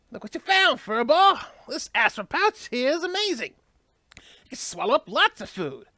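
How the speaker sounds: put-on cheerful Southern US accent for the little companion. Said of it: "I'm suddenly giving him a southern accent".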